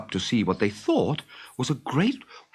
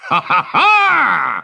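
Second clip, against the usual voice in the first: loud, high, slow villain laugh; booming pirate cackle; evil laugh; 10 semitones higher, 9 dB louder, much slower than usual. Acted. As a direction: cackle in triumph. Hook laughing at his plan.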